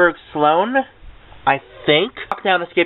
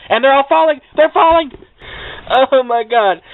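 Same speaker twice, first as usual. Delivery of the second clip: real panic, pitch shooting higher and louder; panicked; 8 semitones higher, 3 dB louder than usual. Real, not acted. Vlogger watching her books fall, real panicked cry. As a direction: books tumbling off the shelf, voice spiking in alarm. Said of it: "like, freaking out".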